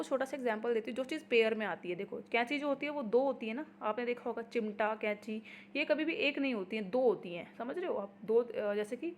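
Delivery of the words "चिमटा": normal talking voice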